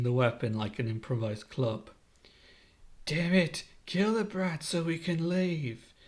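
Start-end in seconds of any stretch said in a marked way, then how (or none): 3.1-5.9 higher-pitched, exasperated order; frustrated command with little added volume; lightly acted villain yell; mild angry yell. A narrator's reading. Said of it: "Higuma yells".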